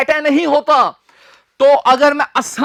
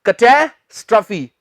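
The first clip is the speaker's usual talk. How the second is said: Catastrophe split as "kuh-TAE... struh-fee"; catastrophe in two teaching chunks; broken into parts. Shown as a demonstration. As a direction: break catastrophe in two, pausing after "TAE". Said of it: "ये तो मैंने तोड़कर बोल दिया".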